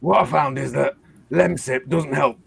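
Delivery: football manager with a bad throat; Sean Dyche. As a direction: sound like a manager nursing a cold. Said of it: "You're supposed to sound like Sean Dyche".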